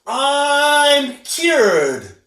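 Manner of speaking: high, loud triumphant cry; joyful shout. A narrator's reading. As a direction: burst out with relief. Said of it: "she shouted".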